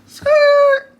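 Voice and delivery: excited shout. Real, not acted. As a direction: blurt it out in a burst. Sudden exclamation at shoes.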